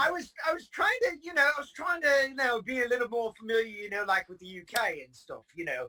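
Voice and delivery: high, playful mock British accent; hammed-up British accent, pitched well up at first; put-on British accent. Teasing the UK hosts after a glitch, mock British accent. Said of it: "That's a good accent".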